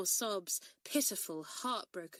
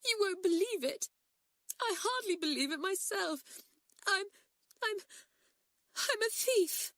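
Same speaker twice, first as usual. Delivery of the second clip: higher, halting, stammering through tears; tearful confession; 13 semitones higher, 3 dB louder than usual. A narrator's reading. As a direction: force the confession out between sobs, higher. Crying girl confesses theft.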